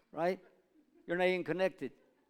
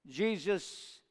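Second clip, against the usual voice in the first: ordinary delivery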